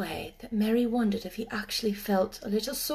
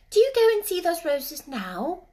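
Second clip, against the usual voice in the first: put-on young girl voice; 8 semitones higher, 5 dB louder than usual. Mary speaks.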